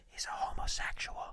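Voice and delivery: whispered aside, voice gone. Acted. Confiding to the audience, whispered aside. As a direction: drop to a hush on it.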